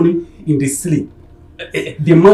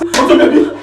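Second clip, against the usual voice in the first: high, frantic repeated shouting; worked-up, out-of-control fury; frenzied rage; 11 semitones higher, 4 dB louder than usual. Acted. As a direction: yell the name in a high frenzy. Enraged husband attacking the man.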